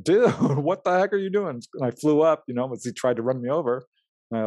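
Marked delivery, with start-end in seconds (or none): 0.0-1.6 high, laughing disbelief; a stunned laugh at the attacker; startled outcry. Retold or imitated. Guy tries to run him over.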